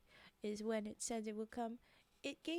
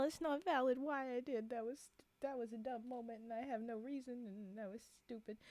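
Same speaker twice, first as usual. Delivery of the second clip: ordinary talk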